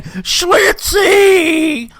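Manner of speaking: high wailing mock cry for a lost pet; a lonely old woman in a walker calling for her lost pet, in a high mock wail. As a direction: wail the pet's name like a heartbroken old lady. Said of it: "and she's crying".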